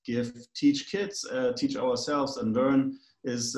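normal talking voice

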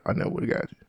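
hushed whisper to himself; whispered aside. Shown as a demonstration. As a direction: breathe it like a private thought. Muttered aside, then repeated.

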